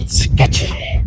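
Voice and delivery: whispered echo of the word; playful whisper. Pretend. Explorer sizing up the building before going in, playful whisper. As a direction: whisper it for spooky effect. Echoes own word for effect.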